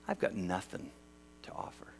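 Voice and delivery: dejected inner voice. Acted. Voicing a discouraged listener.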